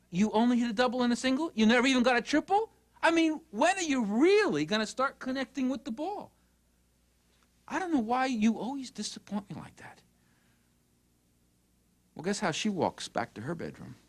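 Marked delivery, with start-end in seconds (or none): 0.1-10.0 high-pitched, incredulous nagging; mock exasperated parental criticism; disappointed parent. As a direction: act a parent let down by the score. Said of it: "you hear yourself saying".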